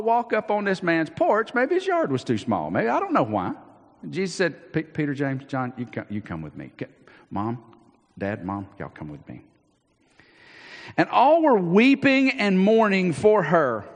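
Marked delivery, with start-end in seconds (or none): 4.7-9.4 soft, low, calm calling; softer and lower toward the end; quiet summons. Acted. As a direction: gather them in gently. Jesus picks who comes in.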